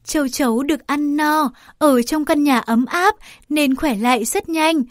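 ordinary delivery, nothing marked

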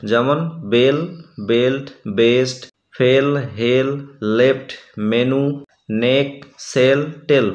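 বেল্ট, বেস্ট said slowly with a short e. Shown as a demonstration. Teacher to class, careful demo of the short e sound. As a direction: sound the short e clearly in ফেল, মেনু, টেল. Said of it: "এর মতো sound পাচ্ছি".

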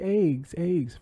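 high-pitched "aygs" for "Eggs, eggs"; mocking eggs pronunciation. Imitating how people say it, high mocking "aygs". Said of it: "People are just like".

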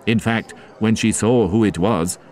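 plain reading voice

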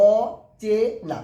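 অচেনা said very slowly, each syllable separated; slow counting demo of অচেনা for students. Shown as a demonstration. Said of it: "অচেনা কবারে উচ্চারণ করছি?".

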